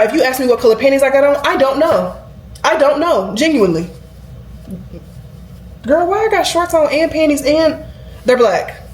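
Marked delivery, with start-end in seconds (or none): 5.8-8.9 sassy aside. Real, not acted. Sassy aside about her own clothes.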